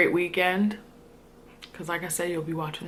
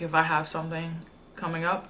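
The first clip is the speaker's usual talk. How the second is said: ordinary talk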